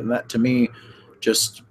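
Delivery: usual speaking voice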